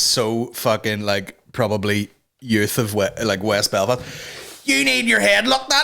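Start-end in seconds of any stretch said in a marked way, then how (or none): 4.6-5.8 much higher shrill scolding retort; high scolding woman. Retold or imitated. Woman scolding the kid, a high shrill retort. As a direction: snap it back like the furious woman. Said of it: "She goes".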